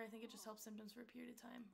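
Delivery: ordinary talk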